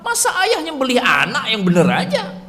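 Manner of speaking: usual speaking voice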